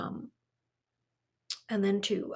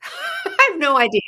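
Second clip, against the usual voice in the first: loud, higher, laughing reply; laughing admission; 5 semitones higher, 8 dB louder than usual. Real, not acted. Traveler asked how she picks destinations, laughing admission. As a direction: burst out laughing as you answer. Laughs at question about her planning.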